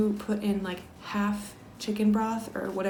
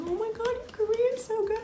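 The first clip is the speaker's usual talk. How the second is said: much higher, squealing praise; gushing compliment. Retold or imitated. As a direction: gush over her Korean like an excited new friend. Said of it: "She's like".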